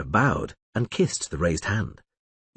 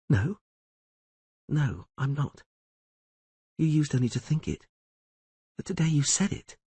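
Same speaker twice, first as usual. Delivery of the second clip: Yennefer, touched; 6 semitones higher than usual. A narrator's reading. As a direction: let her voice lift, moved. Yennefer voiced by narrator.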